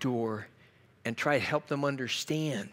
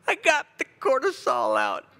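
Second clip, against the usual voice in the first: laughing aside; 12 semitones higher, 6 dB louder than usual. Real, not acted. Joking about a good cry.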